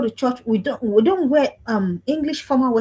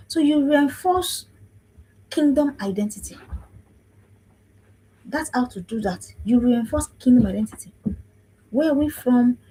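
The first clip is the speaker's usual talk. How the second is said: ordinary talk, nothing marked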